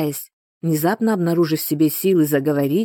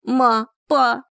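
terrified cry; 7 semitones higher than usual. Acted. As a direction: cry out for his parents. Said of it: "закричал он".

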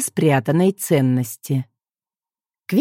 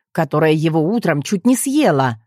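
usual speaking voice

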